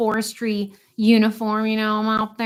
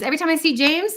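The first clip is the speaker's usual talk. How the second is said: ordinary delivery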